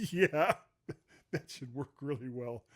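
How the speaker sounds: amused, laughing through the words. Real, not acted. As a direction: let a chuckle break into the reply. Breaks up laughing at joke.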